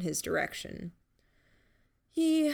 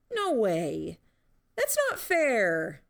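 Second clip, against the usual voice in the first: whiny objection climbing high and drawn out; outraged, pitched-up complaint; indignant protest; 5 semitones higher, 4 dB louder, slower than usual. Acted. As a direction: protest the unfairness, stretching the last words. Protests being called late bloomer.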